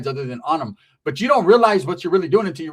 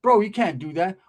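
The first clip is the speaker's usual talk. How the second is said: plain reading voice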